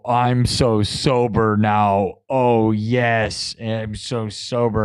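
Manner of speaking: slow, lazy mock bliss; mock blissful. Imagining himself soaking in a crawfish boil bath, mock blissful. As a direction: luxuriate in it, slowly and smugly. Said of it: "And I go".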